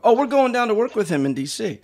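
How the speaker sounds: mimicking someone's eager surprise; excited retold reaction. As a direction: blurt it out as delighted recognition. Guys react excitedly to the name.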